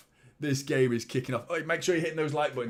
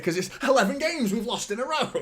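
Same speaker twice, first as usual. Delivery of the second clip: ordinary talk, nothing marked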